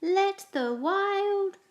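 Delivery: high, loud, slow proclamation; cried out. Acted. Max proclaims the rumpus to his wild subjects, loud, high and slow. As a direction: stretch it out like a royal decree. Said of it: "cried Max".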